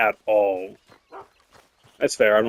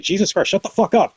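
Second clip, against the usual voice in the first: high mock yell at the coworker; retold snap; 9 semitones higher, 4 dB louder than usual. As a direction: fire it off like losing patience.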